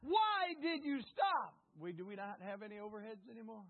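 normal talking voice

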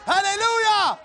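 very high, loud hallelujah; ringing revival shout; shout of praise. Real, not acted. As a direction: holler it out to the congregation.

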